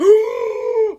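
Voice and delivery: high, drawn-out gasp; mock shocked gasp. Host hearing thanks for a shout out, mock shock. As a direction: suck in air as if scandalised.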